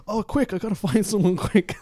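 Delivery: high, loud panicked rush. Retold or imitated. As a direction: blurt it out in a panic.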